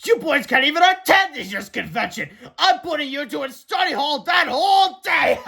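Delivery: high, loud mocking principal voice; gloating principal. A narrator's reading. Principal gloating at the two boys, loud and mocking. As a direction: crow over the boys like a smug principal.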